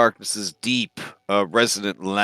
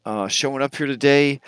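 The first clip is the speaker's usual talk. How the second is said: slightly higher, courteous host address; host character; 4 semitones higher than usual. Acted. Auction host thanks the guests, polite and formal. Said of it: "Honorable Shot Austin says".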